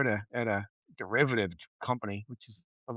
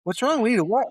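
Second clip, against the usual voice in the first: high, baffled questions; louder, teasing bewilderment; mock confusion; 10 semitones higher, 5 dB louder than usual. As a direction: play dumb, pitched way up. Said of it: "he's like".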